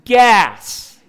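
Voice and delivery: slow, high, booming shout; volume turned up. Acted. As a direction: shout each word slowly at someone who doesn't understand. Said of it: "we turn up the volume".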